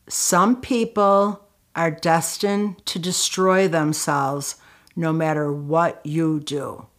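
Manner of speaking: slow, weighty retold advice; slow, deliberate. Colleague giving hard advice to an upset worker, slow and deliberate. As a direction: space it out so each phrase sinks in.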